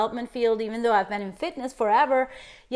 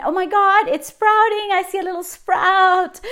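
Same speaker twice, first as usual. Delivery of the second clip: delighted; 8 semitones higher, 6 dB louder than usual. Real, not acted. Delighted at her progress.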